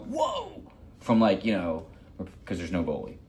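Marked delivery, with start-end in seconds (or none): 0.1-0.6 much higher, amazed little whoa; imitated kids' whoa. Kids on the ice reacting to him whacking the post, mock amazed. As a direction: echo the kids' awe at the hit post. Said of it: "they all go".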